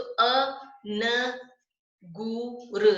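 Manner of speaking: अ न गु र sound by sound, slow; sounded out slowly. Shown as a demonstration. Teacher showing the hidden न in अंगूर, sounded out slowly. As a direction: spell अंगूर out as अ, न, गु, र with gaps. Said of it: "इसको हम कैसे पढ़ेंगे".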